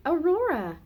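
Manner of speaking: high, loud joyful cry of a name; happy exclaim. A narrator's reading. Goldilocks greeting her friend Aurora, excited exclaim. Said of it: "Goldilocks exclaimed".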